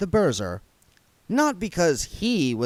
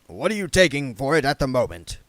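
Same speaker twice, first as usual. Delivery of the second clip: ordinary delivery, nothing marked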